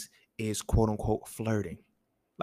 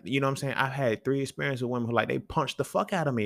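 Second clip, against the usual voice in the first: ordinary talk, nothing marked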